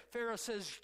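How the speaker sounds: ordinary talk, nothing marked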